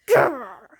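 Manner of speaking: loud dog growl. Acted. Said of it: "He's like".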